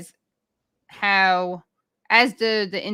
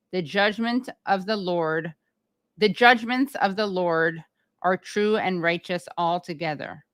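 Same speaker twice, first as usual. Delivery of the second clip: slow, solemn dramatic reading. Shown as a demonstration. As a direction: read it slowly with weight. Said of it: "read it slowly and with drama".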